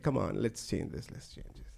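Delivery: low, hushed mock consoling. Lover comforts the crying girl, low soft consoling. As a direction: soothe softly, fading almost to a whisper. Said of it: "he consoles her".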